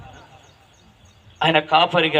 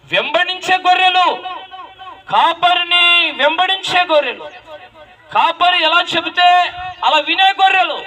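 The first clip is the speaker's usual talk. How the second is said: high, ringing pulpit cry; fiery sermon voice; full-force preaching; 13 semitones higher, 3 dB louder than usual. Acted. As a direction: proclaim it to the whole church.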